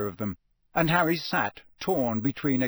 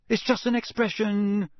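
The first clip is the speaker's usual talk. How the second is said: high-pitched hasty backtrack; quick defensive correction, pitched up; 9 semitones higher, 3 dB louder than usual. A narrator's reading. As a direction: excuse yourself in a hurry. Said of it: "said Ron hastily".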